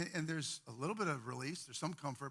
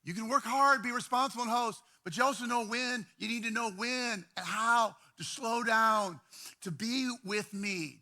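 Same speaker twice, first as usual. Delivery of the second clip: earnest urging; 5 semitones higher, 5 dB louder than usual. Retold or imitated. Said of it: "He goes".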